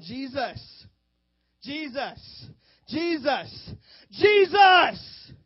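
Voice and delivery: cries rising to a high full-throated shout; blind man crying out. Retold or imitated. Blind Bartimaeus cries to Jesus over crowd, escalating shout. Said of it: "He's like".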